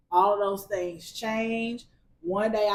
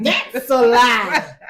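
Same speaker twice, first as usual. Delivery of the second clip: slow, loud shouted "lie"; shouted punchline; 3 semitones higher, 12 dB louder, much slower than usual. Acted. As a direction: belt out "That's a lie".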